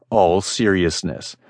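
plain reading voice

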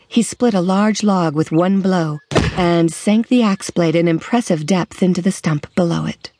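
ordinary delivery, nothing marked

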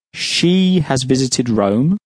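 long, emphatic "she", then the rest follows; stress on she. Shown as a demonstration. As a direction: draw out "she" at a slow pace. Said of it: "again with emphasis".

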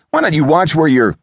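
high-pitched irritated scolding; indignant outburst. A narrator's reading. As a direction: snap at someone who bumped you. Indignant after bumping into someone.

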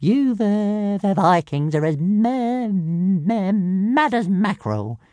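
high-pitched stammering little dragon; squeaky creature voice, stretching and stuttering the words; Toothless. A narrator's reading. Dragon teasing Hiccup about the Vikings, high amused stammer. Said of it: "Toothless chuckled".

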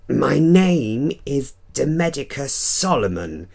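high, slow theatrical vampire growl; angry growl. Acted. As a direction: growl the name with mock menace. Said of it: "his voice an angry growl".

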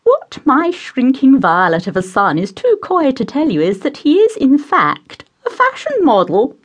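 high, fluttery mother voice; high, gushing matron; doting society mother. Acted. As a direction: play the gushing hostess pouring tea.

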